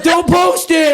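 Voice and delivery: very high, loud mock wail; panicked plea. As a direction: beg in a squealing panic. Acts out plea against posting photo.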